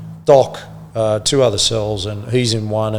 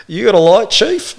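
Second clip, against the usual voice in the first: higher, louder cheeky ask; a cheeky ask as if nothing's odd; cheeky prisoner; 8 semitones higher, 5 dB louder than usual. Retold or imitated. Prisoner asking the cop for a light, higher cheeky voice. Punchline repeated.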